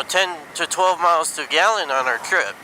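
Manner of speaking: usual speaking voice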